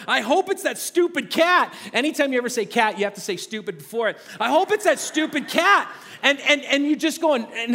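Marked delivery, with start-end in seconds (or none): 0.1-1.7 higher, anxious hoping under the breath; creeping down the stairs, hoping it's nothing; nervous dad. Retold or imitated. Dad on the stairs at night, mock nervous. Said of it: "you're just going". 4.4-5.9 higher, anxious hoping under the breath; creeping down the stairs, hoping it's nothing; nervous dad. Retold or imitated. Dad on the stairs at night, mock nervous. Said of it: "you're just going".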